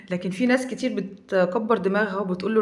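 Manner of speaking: ordinary delivery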